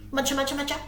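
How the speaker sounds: ordinary delivery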